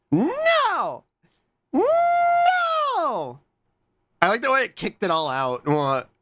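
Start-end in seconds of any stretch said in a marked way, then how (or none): 0.1-3.4 very high, stretched mock wail; drawn-out wail. Streamer reacting to the stream, high wailed no. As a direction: hold the word out in a high cry. Drawn-out cry at what is on screen.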